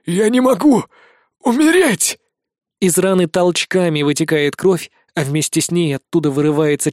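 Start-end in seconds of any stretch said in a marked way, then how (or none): 0.0-2.2 high, panicked denial of death; desperate dying cry. A narrator's reading. Dying villain cries out.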